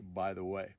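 ordinary talk, nothing marked

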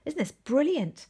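usual speaking voice

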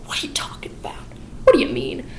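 soft whispered unease; nervous whisper. Retold or imitated. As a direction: ask it in a worried whisper. Miss Levitt nervous about Toby's warning.

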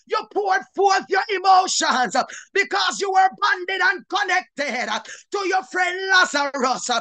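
ordinary talk, nothing marked

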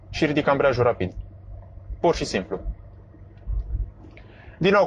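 normal talking voice

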